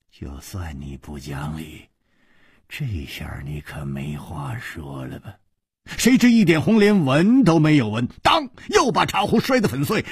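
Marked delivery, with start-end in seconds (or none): 0.1-5.4 low, soft private grumble; inner thought. A narrator's reading. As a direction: think it to yourself under your breath. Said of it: "心里想到".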